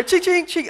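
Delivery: high imitated metal clink; spoon clinking. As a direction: ring it like a spoon hitting tile. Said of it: "I hear".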